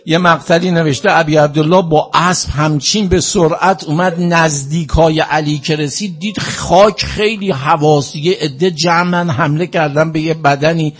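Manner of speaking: loud, high mourning recitation; impassioned lament. Real, not acted. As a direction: preach the tragedy at full force, grieving. Mourning preacher at full force.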